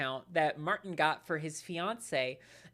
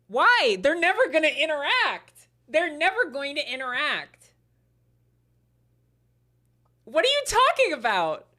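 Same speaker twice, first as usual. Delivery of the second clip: outraged; 9 semitones higher, 11 dB louder than usual. Real, not acted. Outraged reacting to a post.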